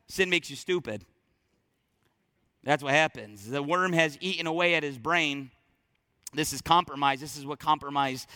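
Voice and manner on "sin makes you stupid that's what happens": usual speaking voice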